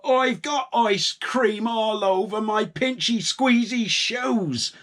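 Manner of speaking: high, slow whiny moan; complaining farmer. A narrator's reading. Farmer Palmer moaning about ice cream on his shoes, high and slow. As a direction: whine about the squeezy shoes. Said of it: "said Farmer Palmer".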